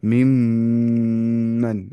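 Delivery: मिम्मन with the m held long so the nose vibrates; very slow मिम्मन with a long buzzing m; nasal ghunna. Shown as a demonstration. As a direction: hum मिम्मन slowly through the nose. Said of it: "नाक करिए vibrate की नहीं".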